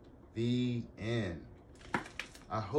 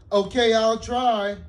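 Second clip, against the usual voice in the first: loud; 15 dB louder than usual. Acted. Ruby promising, still loud.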